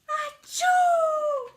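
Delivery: long, loud, high comic sneeze; huge sneeze. Acted. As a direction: let out an enormous sneeze. Gerald sneezes.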